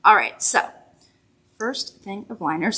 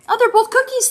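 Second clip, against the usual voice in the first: surprised; 14 semitones higher, 6 dB louder than usual. Real, not acted. Realizes she was wrong.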